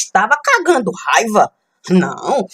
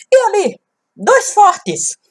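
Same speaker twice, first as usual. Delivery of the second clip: dramatic declaration; 13 semitones higher, 4 dB louder than usual. Acted. Dramatic reveal.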